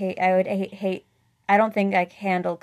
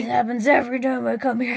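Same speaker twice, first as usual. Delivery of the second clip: quick, off-kilter parody of a whiny customer; mock grumpy customer; 3 semitones higher, faster than usual. Coworker parodying the rude customer's line, quick mocking voice. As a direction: mimic him to make fun of the complaint.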